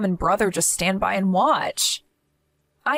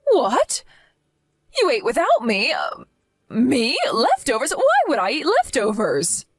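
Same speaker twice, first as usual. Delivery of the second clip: indignant outrage; 6 semitones higher than usual. Acted. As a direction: bristle at the insult of leftovers. Mother outraged at being offered leftovers.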